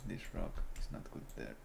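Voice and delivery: hushed, voiceless placement notes; whisper. Real, not acted. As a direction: whisper to yourself while building. Whispering while building.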